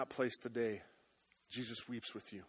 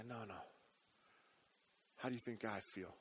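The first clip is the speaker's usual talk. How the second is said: tender reply; 3 semitones lower, 8 dB quieter than usual. Pretend. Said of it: "Jesus just simply wants to respond".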